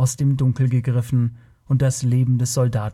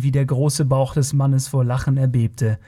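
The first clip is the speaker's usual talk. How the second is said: ordinary delivery, nothing marked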